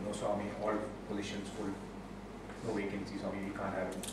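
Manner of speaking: very soft, low, apologetic official; hushed, low reply to a superior; meek refusal. Retold or imitated. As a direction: turn him down quietly and deferentially.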